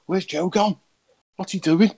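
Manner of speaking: high-pitched bewildered questions; mock bewilderment at a sudden disappearance; puzzled reaction. Retells own puzzled reaction.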